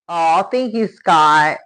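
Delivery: normal talking voice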